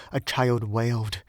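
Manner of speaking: ordinary delivery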